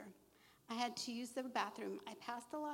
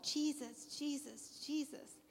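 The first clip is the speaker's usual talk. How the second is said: higher-pitched retold plea, not shouted; retold crying out; 4 semitones higher, slower than usual. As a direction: call out higher, recalling a desperate prayer. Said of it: "I cried out".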